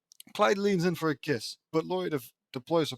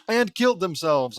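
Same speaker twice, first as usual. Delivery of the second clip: louder, stressing "killed"; grim emphasis; 4 semitones higher, 8 dB louder than usual. Shown as a demonstration. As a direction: lean hard on "killed". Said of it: "I respond".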